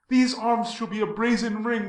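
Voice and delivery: ordinary delivery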